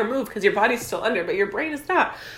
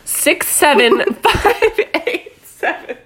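silly put-on reading; 7 semitones higher, 8 dB louder, much slower than usual. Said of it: "say it with your bussy".

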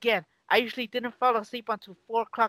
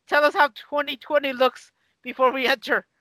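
louder and higher, playfully calling; 5 semitones higher, 3 dB louder than usual. Real, not acted. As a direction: call out to a friend far away. Calling to Roddy again, laughing.

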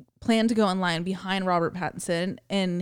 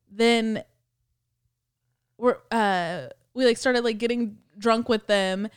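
normal talking voice

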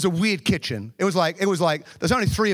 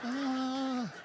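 stifled sound; 7 semitones higher, 13 dB quieter than usual. Retold or imitated. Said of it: "it's gotta come out of".

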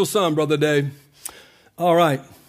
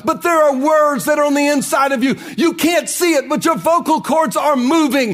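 preaching at full force; 6 semitones higher than usual. Acted. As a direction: climb into a rousing sermon peak. Preacher builds to climax.

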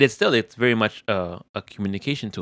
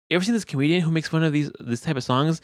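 fast wife voice; 6 semitones higher, faster than usual. Retold or imitated. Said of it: "she started making".

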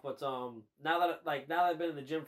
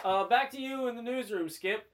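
bright anchor voice handing off; news anchor sign-off; 7 semitones higher, 3 dB louder than usual. Pretend. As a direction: toss it back to the studio.

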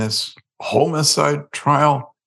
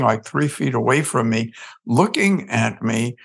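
ordinary talk, nothing marked

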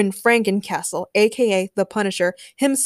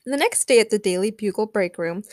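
ordinary delivery

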